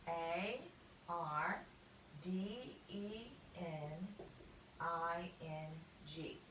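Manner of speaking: letters spelled out slowly one by one; slow spelling. Shown as a demonstration. Teacher spelling gardening letter by letter, slowly. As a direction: spell each letter out carefully. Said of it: "we would just spell it".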